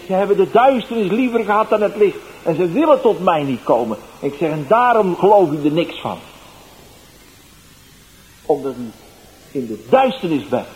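loud, high preacher's rebuke; bold rebuke. Retold or imitated. Evangelist answering the heckler boldly.

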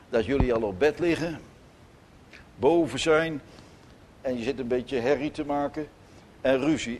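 ordinary delivery

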